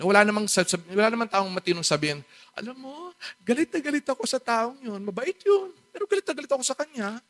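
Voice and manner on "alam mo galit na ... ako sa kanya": grudging person. Retold or imitated. Imagined person voicing a grudge.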